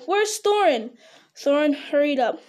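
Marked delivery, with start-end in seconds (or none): 0.1-0.9 high-pitched call; urgent cry. A narrator's reading. Bilbo looking for Thorin by the rock, high urgent call. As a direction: call out urgently for a missing companion. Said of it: "cried Bilbo".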